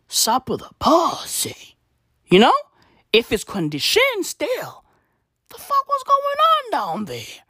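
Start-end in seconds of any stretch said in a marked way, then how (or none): 0.1-1.8 put-on voice. Crude joke. 5.5-7.4 high, stretched, incredulous put-on voice; squeaky outraged voice. Comedian joking about her surgery, high squeaky disbelief.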